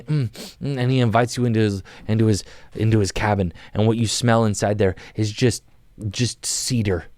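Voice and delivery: ordinary talk, nothing marked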